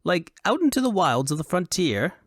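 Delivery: high-pitched, excitable foppish voice; high and animated Elvunian dandy; high Elvunian fop. A narrator's reading. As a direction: play a foolish fop in a high, affected register. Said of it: "he said in a high Elvunian accent".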